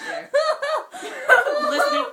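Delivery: squeaky high laughter breaking through the words; can't get the line out for laughing. Real, not acted. Embarrassed by her old story, cracking up.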